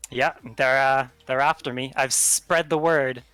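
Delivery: ordinary talk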